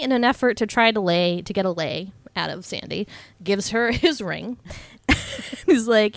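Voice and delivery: usual speaking voice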